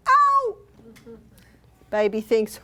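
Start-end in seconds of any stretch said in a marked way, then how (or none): 0.1-0.6 sharp high yelp, much louder; startled ow, loud and high; sudden yelp of pain. Retold or imitated. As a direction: yelp as if bitten. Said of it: "and I go".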